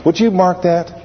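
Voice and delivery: usual speaking voice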